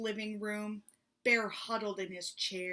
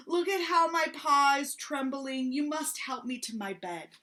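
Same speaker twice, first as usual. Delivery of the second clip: feeble sick bear; 6 semitones higher, 8 dB louder than usual. Acted.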